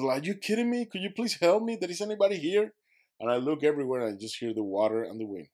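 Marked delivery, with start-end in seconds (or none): 0.2-2.7 high, louder desperate calling out; calling out for help. Retold or imitated. Stranded swimmer calling out to anyone nearby, desperate. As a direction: shout across the water for anyone.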